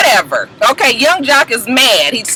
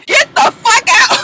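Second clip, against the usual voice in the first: high, slow, choked crying yell, louder; crying shout; 12 semitones higher, 3 dB louder than usual. Retold or imitated. Baby Knees throwing Jock out, crying shout. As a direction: throw him out mid-sob, drawn out. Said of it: "here came the voice constriction again".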